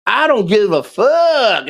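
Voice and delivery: imitation of her not caring; imitated defiant swearing. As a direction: draw it out, mimicking her swearing. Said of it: "She'll be like".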